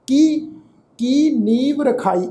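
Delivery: ਕੀ as a separate word, then ਨੀਵ ਰਖਾਈ; ਕੀ set apart, ਵ closing ਨੀਵ before ਰਖਾਈ; wrong word split. Shown as a demonstration. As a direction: break after ਕੀ and join ਨੀਵ as one word. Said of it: "ਇਸ ਢੰਗ ਨਾਲ ਪਾਠ ਉਚਾਰਨ ਕਰਦੇ ਆ".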